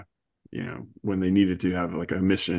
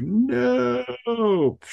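dramatic big no. Retold or imitated. Dramatic comic no.

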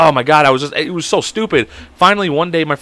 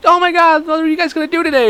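very high gushing imitation; excited girl; 13 semitones higher than usual. Imitating the cousin greeting the guys, high excited girl voice. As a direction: mimic her, pitched way up. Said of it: "then she'd be like".

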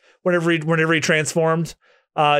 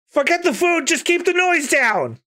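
high, squealing critter voice; squeaky Chopper voice; 11 semitones higher than usual. Acted. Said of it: "no, it's Nami saying that".

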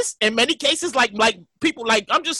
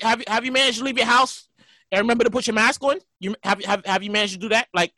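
ordinary talk

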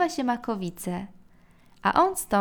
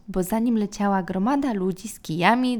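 ordinary delivery

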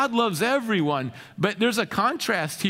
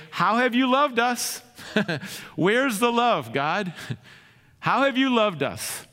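incredulous complaint; 6 semitones higher than usual. Acted. The people challenging God, mock incredulous and raised. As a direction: voice the people's bitter disbelief at God. People questioning God's love.

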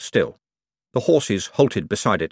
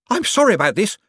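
high, breathless apology; flustered, high-pitched panting delivery; 11 semitones higher, 4 dB louder than usual. A narrator's reading. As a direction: apologise while out of puff. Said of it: "panted Hicks".

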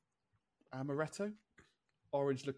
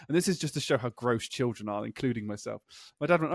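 usual speaking voice

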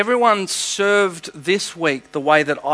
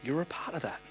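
much softer, hushed and gentle; tender soft voice of Jesus; 2 semitones lower, 12 dB quieter than usual. Retold or imitated. Jesus tenderly blessing his faithful son, softly. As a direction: speak it like a loving father's blessing. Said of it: "And he said".

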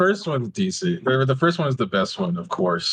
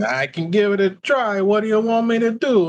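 raised-pitch attempt at an Italian accent; 7 semitones higher than usual. Shown as a demonstration. Miles trying Italian on request, mock Italian accent. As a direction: give a playful Italian accent a try. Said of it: "can you do a good, uh, Italian".

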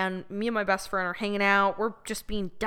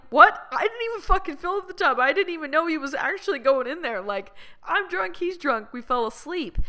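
much higher, fast, incredulous protest; indignant disbelief; 8 semitones higher than usual. Retold or imitated. Accused of nearly drowning her friend, protesting in disbelief. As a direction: rattle it off in shocked defence. Defending herself against ex's accusation.

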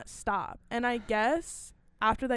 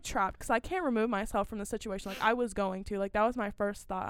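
normal talking voice

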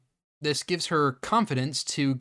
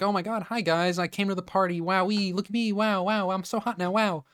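higher, giddy show-off voice; mock-gushing, pitched up; gushing party girl; 5 semitones higher than usual. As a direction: imitate a girl thrilled with herself. Voicing Cassie showing off at party.

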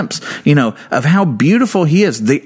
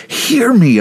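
pleading cry; 9 semitones higher than usual. Acted. Voicing David's plea.

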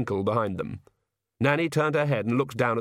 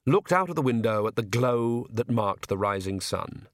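ordinary delivery, nothing marked

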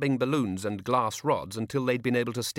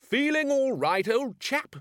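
high, loud, slow bellow into the tube; booming call to the machine; shouted; 3 dB louder, slower than usual. A narrator's reading.

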